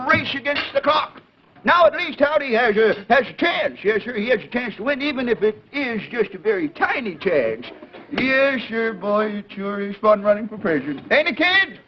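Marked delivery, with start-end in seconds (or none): none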